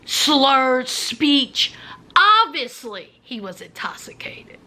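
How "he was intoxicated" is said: hushed confiding whisper. A narrator's reading. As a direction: let it drop to a whisper. Hushed aside in story.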